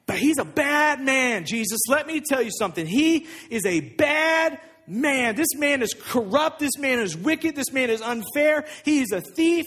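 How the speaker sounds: high-pitched, hammering accusations; indignant rant. Acted. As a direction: pile up the charges, outraged. Disciple rails against Levi to Jesus.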